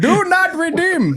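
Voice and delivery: very high, slow, breaking into laughter; laughing scammer voice. Retold or imitated. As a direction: squeal it slowly while cracking up. Scammer meme line repeated.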